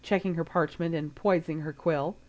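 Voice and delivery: plain reading voice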